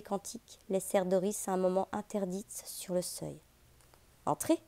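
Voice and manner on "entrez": high inviting call. Acted. Julio invites Doris into chapel.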